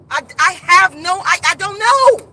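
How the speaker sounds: loud, high, exasperated insistence; forceful, worked-up denial; emphatic disclaimer. Real, not acted. Insists she is not accusing anyone.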